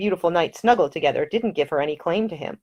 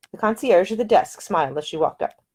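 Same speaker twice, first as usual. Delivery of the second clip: ordinary talk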